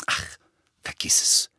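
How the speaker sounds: breathy, curt brush-off; annoyed. Acted. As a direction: wave him off irritably. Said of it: "erwiderte Hermine ärgerlich".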